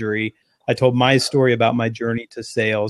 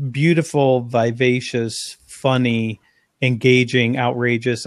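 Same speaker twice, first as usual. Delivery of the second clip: ordinary delivery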